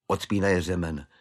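ordinary delivery

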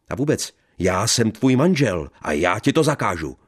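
loud, high furious command; angry shout. Acted. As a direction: roar your authority. Husband asserts authority. (vzteklý křik)

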